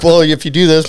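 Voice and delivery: a lightly voiced Paul butting in with instructions; imitating Paul.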